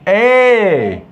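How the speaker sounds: E said as a long drawn-out "ay"; syllable demo. Shown as a demonstration. Teacher prompts Mohamed with the syllable, long held é. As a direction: stretch E into a held é. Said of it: "la syllabe".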